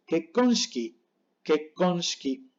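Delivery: kekonshiki with a stop before "kon", ending "shki"; hushed I in kekonshiki after a doubled K; pause and silent I. Shown as a demonstration. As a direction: break kekonshiki as "kek", gap, "konshki". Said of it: "hay que hacer pausa".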